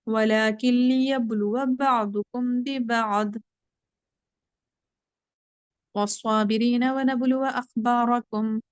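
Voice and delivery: slow, drawn-out tajweed recitation; Quran recitation. Shown as a demonstration. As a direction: chant the verse slowly with care. Teacher recites Quran verse. (धीमी तजवीद वाली तिलावत)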